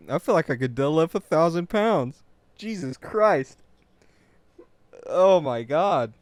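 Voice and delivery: ordinary talk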